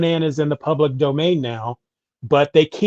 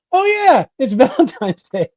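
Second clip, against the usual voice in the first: high-pitched mock surprise, jumping up on "Oh, yeah"; high, louder burst of recalled surprise; retold sudden realization; 9 semitones higher, 4 dB louder than usual. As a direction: imitate someone suddenly remembering, voice leaping up.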